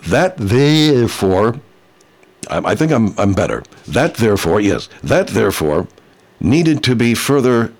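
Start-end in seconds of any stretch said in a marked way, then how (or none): 0.1-1.6 slow careful retry. Shown as a demonstration. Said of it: "I'm gonna try that again".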